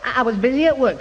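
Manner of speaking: ordinary delivery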